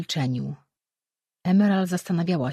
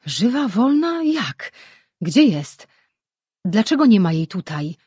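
higher, louder frantic questioning; feverish questions; 4 semitones higher, 6 dB louder than usual. A narrator's reading. Mirar desperate to know where Auraja is, feverish questions. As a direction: fire off questions in a rush. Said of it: "pytał gorączkowo Mirar".

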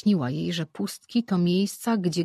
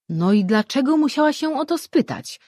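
much higher and louder, indignant outburst; higher, louder exclamation; exclaimed; 9 semitones higher, 6 dB louder than usual. A narrator's reading. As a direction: blurt it out in protest. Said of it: "wykrzyknął Surim".

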